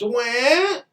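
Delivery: a high mock baby wail; mocking crybaby wail. Mocking women who complain, high fake wail. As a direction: mimic a whining crybaby.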